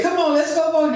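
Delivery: high, loud eager mother; mama urging. Retold or imitated. Mama urging daddy on, high and loud. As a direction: rush the family along. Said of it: "She said".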